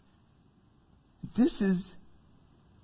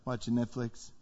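put-on much lower, softer teen mumble; bored son; 10 semitones lower, 9 dB quieter than usual. Son answering his dad, a low bored mumble. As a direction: toss it off like a bored teenager.

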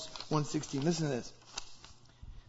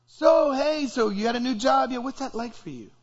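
1 eager friend; 6 semitones higher than usual. Acted. Acted friend asking about job.

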